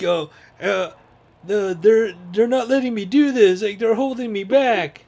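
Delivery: high, loud whining complaint; whiny Anakin. Retold or imitated. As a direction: moan about being held back. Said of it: "he's like".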